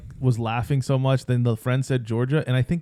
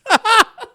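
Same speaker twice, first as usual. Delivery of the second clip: laughing; 7 dB louder than usual. Real, not acted. Laughing at a joke.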